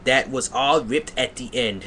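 ordinary delivery

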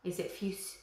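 plain reading voice

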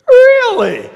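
amused exasperation at the disciples; incredulous Jesus. Retold or imitated. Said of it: "And Jesus goes".

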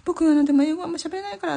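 high-pitched hurried mock admission; retold quick disclaimer. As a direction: blurt it out high and fast, as a quote. Retold nervous upfront admission in English chat.

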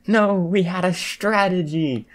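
high, slow, drawn-out wail; protesting yell. A narrator's reading. Mina protests her teammate's winning move, yelling. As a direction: stretch out the outraged complaint. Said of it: "Mina yelled".

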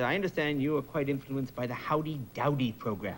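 lightly voiced British interviewer; British chat-show host. Chat-show host interviews a ventriloquist, formal British accent. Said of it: "one of the chat shows".

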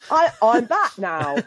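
slow, hammed-up fake English accent; botched English accent. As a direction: stretch out a badly faked English accent. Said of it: "my tongue had forgotten what to do".

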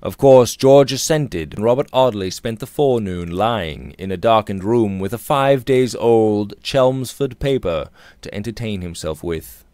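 plain reading voice

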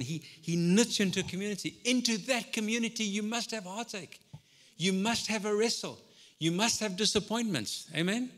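full-force preaching. Acted. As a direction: drive it home to the congregation.

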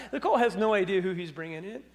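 ordinary talk

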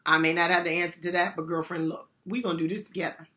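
soft reassurance. Shown as a demonstration. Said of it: "what you gonna say?".

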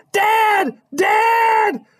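high, panicked scream; frightened kid calling for help; shouting for dad. A narrator's reading. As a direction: yell for dad in terror. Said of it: "he shouted".

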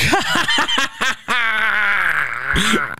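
shrieking burst of laughter. Real, not acted.

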